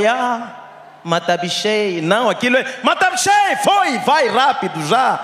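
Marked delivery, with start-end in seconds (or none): 0.1-2.0 relaxed speech. Shown as a demonstration. Comic showing how people talk in peacetime, relaxed. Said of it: "Para você sentar e falar". 2.8-5.2 "Matabichei" shouted much higher and louder, rushed under fire; hurried talk. Shown as a demonstration. Comic showing how Angolans talk in wartime, rushed and loud. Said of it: "Fala rápido".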